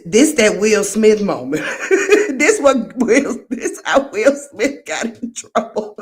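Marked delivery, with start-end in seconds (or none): 1.6-6.0 words bubbling through a laugh; laughing through the line. Real, not acted. Laughing while commenting on clip.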